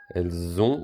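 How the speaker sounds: "Elles ont" said with a linking z; liaison sounded. Shown as a demonstration. Teacher explaining the S to children, "Elles ont" with z liaison. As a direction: join "Elles ont" with a clear z. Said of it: "on fait la liaison".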